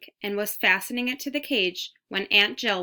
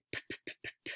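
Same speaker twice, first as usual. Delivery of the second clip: soft unvoiced lip-popping engine noise; motorcycle sputter; 14 dB quieter than usual. A narrator's reading. Mouse shows Garf his motorcycle noise, sputtering softly. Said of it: "he sputtered".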